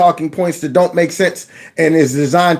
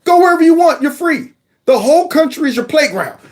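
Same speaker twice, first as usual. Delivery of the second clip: sarcastic welcome; 12 semitones higher than usual. Acted. Host mock-welcoming lawbreakers to the country, high sarcastic proclamation. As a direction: hand out freedom with heavy sarcasm.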